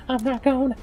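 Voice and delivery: very high, silly cartoon voice; hammed-up high squeaky hero; Ichigo. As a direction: put on an absurdly high character voice. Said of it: "That's my Ichigo impression".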